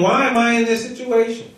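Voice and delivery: high-pitched exasperated griping; complaining. Acted. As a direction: complain as a fed-up Moses pleading with God. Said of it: "he's complaining".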